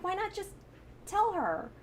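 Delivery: high-pitched exasperated plea; pleading, frustrated protest. Retold or imitated. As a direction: replay your frustration at the film, voice pitched well up. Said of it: "one of those frustrating moments".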